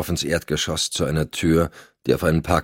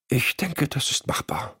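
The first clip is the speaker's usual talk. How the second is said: softer, almost whispered reply; murmured; 7 semitones higher, 7 dB quieter than usual. Acted. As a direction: breathe it back, barely audible. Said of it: "raunte Cotton".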